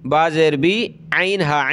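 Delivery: ordinary delivery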